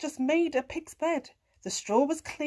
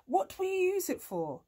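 neighing horse; 7 semitones higher than usual. A narrator's reading. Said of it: "he neighed".

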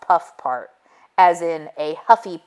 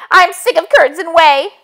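high, loud angry demand; screaming; 11 semitones higher, 8 dB louder than usual. A narrator's reading. Muffy screaming at the wolf for her muffins. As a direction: yell it at the wolf. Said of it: "screamed Muffy".

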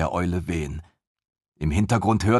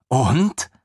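puzzled short question, pitched up; uncomprehending; 6 semitones higher, 4 dB louder than usual. Acted. Desina asking Seraphine, puzzled and blank. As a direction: she doesn't see the point. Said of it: "fragte Desina verständnislos".

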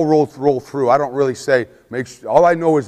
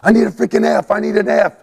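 high urgent yell at the players; frantic coach yelling for the F; yelling the call; 8 semitones higher than usual. Retold or imitated. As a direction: bark the play call to hurry them. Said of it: "You're yelling and screaming".

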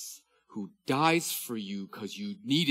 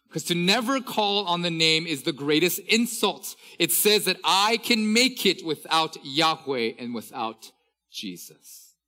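louder, higher emphatic read of the quote; preacher reading a professor's quote with fervour; preaching quote reading; 5 semitones higher, 7 dB louder than usual. Acted. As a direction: press each phrase home to the congregation.

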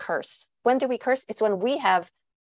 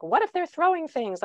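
higher, lightly voiced anxious question; worried parent; 7 semitones higher than usual. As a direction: toss it up like a fretful parent asking. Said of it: "Sometimes people say to me".

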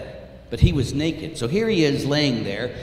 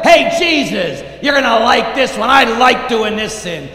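loud, high taunting call; brash, raised-voice defiance; brazen sinner; 8 semitones higher, 9 dB louder than usual. Acted. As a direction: shout it defiantly up at Jesus. Acts sinner defiantly addressing Jesus.

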